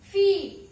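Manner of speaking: फी called out loud and high; loud sound demo. Shown as a demonstration. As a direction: pop फी out sharply so the class hears the short ई. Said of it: "आपको ध्यान देना है". (फी को ज़ोर से, ऊँची आवाज़ में, छोटी ई के साथ)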